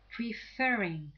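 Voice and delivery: Preferring, slow, with FER punched; stress on second syllable. Shown as a demonstration. Teacher adding I N G, Preferring stressed on FER. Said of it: "have the stress on the second syllable".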